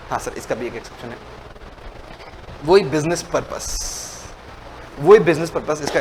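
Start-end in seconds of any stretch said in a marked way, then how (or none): 0.1-1.2 low, soft confirming answer; hushed, much lower admission; quiet concession. Acted. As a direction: drop right down to answer the question. Low answer to voiced student question.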